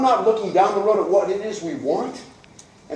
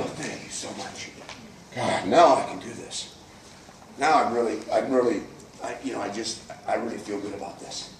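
relieved soft imitation; 8 semitones lower, 5 dB quieter than usual. Melancholy thanks for ten points.

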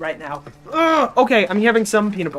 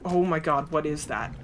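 usual speaking voice

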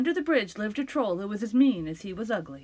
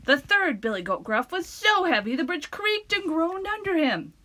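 plain reading voice

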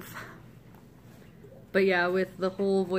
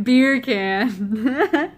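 "Beer can" louder, like "bacon"; Jamaican accent; 5 semitones higher, 10 dB louder than usual. Shown as a demonstration. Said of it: "bacon in Jamaican accent".

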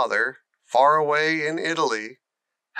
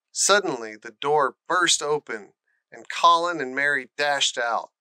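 ordinary delivery

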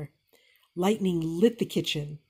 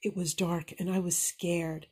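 usual speaking voice

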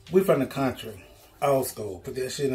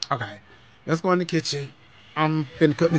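ordinary talk

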